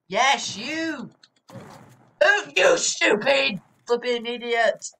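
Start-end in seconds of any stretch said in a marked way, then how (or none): 2.2-4.9 raised, loud scolding; exasperated yell. Real, not acted. Player fed up with officer in game, exasperated yell.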